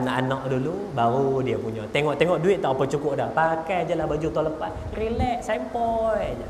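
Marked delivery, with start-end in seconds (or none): 5.0-6.5 very slow, soft and easy; laid-back. Acted. Father in last year's clothes, speaking slow and unbothered. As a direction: shrug it off, no worries.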